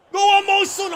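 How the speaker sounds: high, loud roar of encouragement; excited shout. Real, not acted. Gamer urging his striker on, roaring loud and high. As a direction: scream him on to score. Urging player on before the shot.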